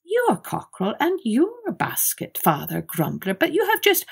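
high, startled protest; a nervous host pleading innocence; flustered innkeeper. A narrator's reading. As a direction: innkeeper caught out, squeaking back.

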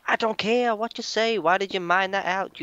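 high-pitched exasperated complaint; annoyed outburst. Real, not acted. Gamer scolding teammate for mining out, exasperated.

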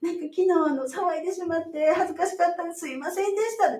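a hint of a sheepish new mother; meek apology. Mother apologising to midwife the next day, mildly meek. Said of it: "コロッと塩らしくなって".

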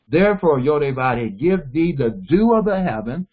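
Therefore, Yodevah give thee the dew of the heaven, said slowly with weight on each word; slow it down so the class catches each part of the blessing. Shown as a demonstration. Teacher slowing the blessing so students hear each part. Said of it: "let me slow this down".

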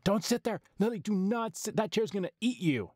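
high-pitched mock warning; hammed-up horror-film viewer alarm. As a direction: warn her in exaggerated panic, pitched high. Retells yelling advice at the film.

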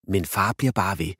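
ordinary delivery, nothing marked

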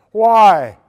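a sharp, loud "Why?"; loud retold question. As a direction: fire the question at him. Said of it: "Isn't that a great question".

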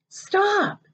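high sharp command; commanding stop. Acted. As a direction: order them to stop, now. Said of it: "he commanded".

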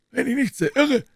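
the doctor realising who tricked Silas; doctor calling out. A narrator's reading. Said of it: "rief Doktor Noel".

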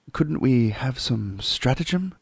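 put-on boy thinking aloud; Peter's voice. Peter proposing a plan, lightly voiced boy.

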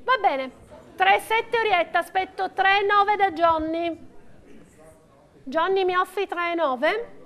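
steady rhythmic bid calling; auctioneer calling bids. Real, not acted. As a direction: call out each bid to the room.

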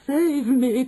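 high loud terrified cry; desperate cry. Acted. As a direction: cry out for your life. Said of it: "cried the wretched witch".

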